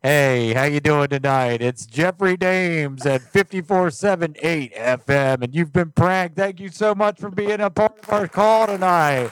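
loud, high-pitched radio DJ reveal; booming on-air sign-off, pitch climbing to the end; radio prank-show host. Pretend.

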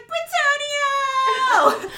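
high, loud, long-drawn shout; imitating someone hollering a name in passing; mock call-out. Fan imitating a man who walks by the photo op, drawn-out holler. Imitates Nick calling out walking by.

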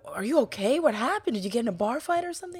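high, quick worried questions, lightly acted; imitating friends fussing over a beat-up classmate; mock concerned asking. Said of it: "we'd ask him".

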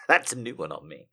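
usual speaking voice

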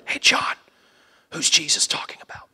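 unvoiced whisper. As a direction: disciple whispering to John. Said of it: "they whisper".

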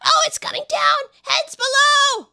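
high, loud cry of alarm, stretched out; warning shout. Acted. Worker warning those below of the falling slate, high alarmed shout. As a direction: yell a warning as the slate falls.

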